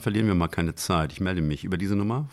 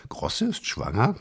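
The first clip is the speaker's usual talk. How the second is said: higher-pitched, lightly amused delivery; leichtes Kichern; 7 semitones higher than usual. A narrator's reading. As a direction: let a hint of a giggle into it. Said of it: "kicherte er". (belustigt, leicht kichernd)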